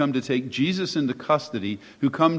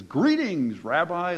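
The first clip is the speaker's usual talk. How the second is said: swooping, higher mock-cordial hail; feigned friendly greeting; 3 semitones higher, slower than usual. Judas greeting Jesus like a long-lost friend, feigning innocence. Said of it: "like it's a long-lost friend".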